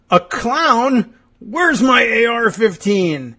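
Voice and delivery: high, loud mock outrage; alarmed husband. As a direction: answer in hammed-up alarm, stretching the words. Husband answers wife's acted alarm.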